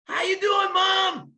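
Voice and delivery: put-on high, shouted little-boy voice calling from the pool; little kid calling mom. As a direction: call out to mom like a carefree four-year-old mid-race. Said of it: "He goes".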